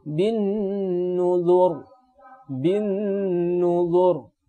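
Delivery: bin nuzur stopped on a heavy final ra; thick ra. Shown as a demonstration. Teacher giving students another example: thick final ra. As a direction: recite nuzur with the last ra full. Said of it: "ini juga dibaca dengan tebal".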